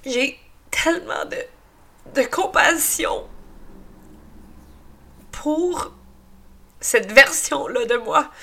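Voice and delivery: tearful, voice breaking; crying. Real, not acted. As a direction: speak through real tears. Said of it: "moi qui pleure de même". (en pleurant, la voix qui se brise)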